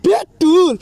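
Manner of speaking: high, loud outburst; loud exclamation. Acted. Exclaims loudly over the petrol.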